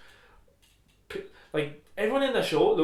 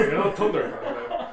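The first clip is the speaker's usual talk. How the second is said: laughing tease; 5 semitones higher, 4 dB louder, slower than usual. Acted. Repeating tease while laughing.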